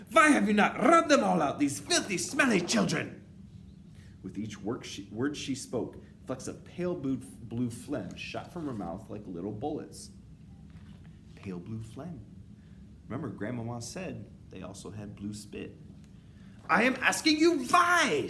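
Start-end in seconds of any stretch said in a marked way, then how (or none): 0.1-3.2 high, very loud witch shriek; raging witch tyrant; furious scream. A narrator's reading. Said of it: "she screamed". 16.7-18.3 slow, high witch shriek climbing to the last word; raging interrogation; furious scream. A narrator's reading. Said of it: "she screamed".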